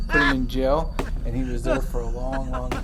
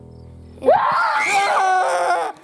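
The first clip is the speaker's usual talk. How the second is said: high frightened shriek; startled scream; 4 dB louder than usual. Real, not acted. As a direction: scream from a real scare.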